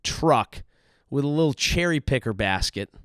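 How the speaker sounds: normal talking voice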